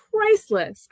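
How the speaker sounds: priceless pitched very high on the first syllable; emphatic PRICELESS. Real, not acted. Host marvels to cousin at scrapbook, high emphatic "priceless". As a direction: punch "priceless" high, falling away.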